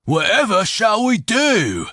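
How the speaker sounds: milk the fake helplessness; mock despair. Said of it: "his mouth formed a terrified O".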